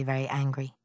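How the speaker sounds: ordinary delivery, nothing marked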